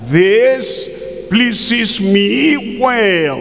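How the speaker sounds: high, slow, drawn-out pleased tone; mock pleased wife. Wife insisting to her husband, mock drawn-out and pleased. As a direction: stretch out "pleases me well" like a self-satisfied wife.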